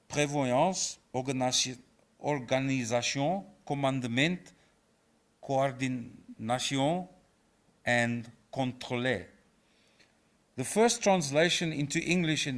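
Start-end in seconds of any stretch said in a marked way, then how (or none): 0.1-9.3 French terms in a hammed-up French accent; deliberately poor French accent; self-mocking French accent. Shown as a demonstration. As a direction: say each term with a bad French accent, drawn out. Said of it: "in my worst French accent".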